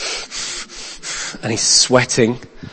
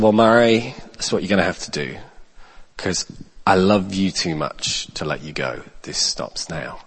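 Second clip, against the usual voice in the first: soft tender firmness; 4 semitones lower, 11 dB quieter than usual. Retold or imitated. Gently telling angry man to stop.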